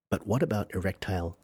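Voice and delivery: ordinary delivery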